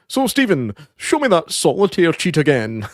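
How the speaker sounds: mock chirpy news reporter; playful presenter tease. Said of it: "the reporter turns to him and goes".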